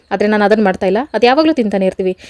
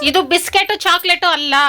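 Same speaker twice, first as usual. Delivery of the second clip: talking to pet; 7 semitones higher than usual. Real, not acted. Telling dog it's not for him.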